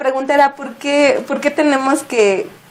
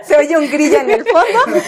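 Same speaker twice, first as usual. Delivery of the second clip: words forced out through laughter, higher; laughing; 10 semitones higher, 3 dB louder than usual. Real, not acted. Ríe por el silencio incómodo. (riendo a carcajadas)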